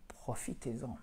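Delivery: hushed, confidential whisper; very soft whispered invitation; whispered aside. Real, not acted. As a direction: whisper it like a tip slipped in. Closing the plug in a whisper.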